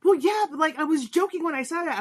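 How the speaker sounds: a hint of the sheepish son backpedalling; son's protest. Son protests he was joking.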